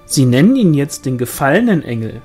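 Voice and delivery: loud, swooping gossip voice; eager village gossip, played up; gossiping sister-in-law. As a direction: pass on village gossip with big pitch swoops. Pauline's gossip, voiced in narration.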